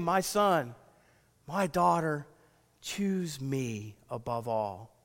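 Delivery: soft, slow and low, calling gently; hushed, tender call from God. Acted. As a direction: plead softly like a loving father. God's voice calling his children.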